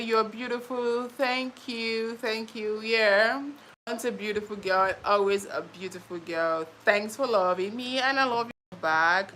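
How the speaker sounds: usual speaking voice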